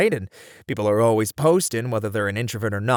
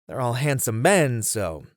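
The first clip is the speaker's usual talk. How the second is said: usual speaking voice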